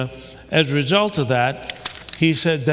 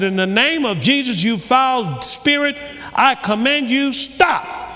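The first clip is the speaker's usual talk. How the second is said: commanding the demon, climbing higher to a sharp stop; desperate command; 8 semitones higher than usual. Retold or imitated. Preacher retelling Hagin rebuking the demon, rising commanding cry. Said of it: "I cried out".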